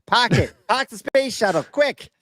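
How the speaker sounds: high, hurried Boston-style "Park it"; mock Boston order. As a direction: bark the order like a Boston pilot. Mock Boston pilot command.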